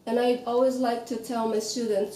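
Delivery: ordinary talk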